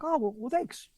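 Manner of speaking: high, put-on polite thanks; lightly voiced, high-pitched famous singer; star's polite reply. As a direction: imitate a star thanking a fan.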